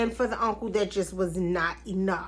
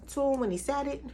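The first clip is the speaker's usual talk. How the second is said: ordinary talk, nothing marked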